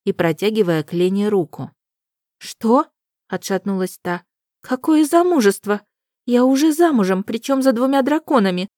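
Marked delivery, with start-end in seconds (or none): 2.4-2.9 high startled cry. A narrator's reading. Heroine startled. 4.6-8.7 high, loud blurted protest. A narrator's reading. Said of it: "выпалила эти слова".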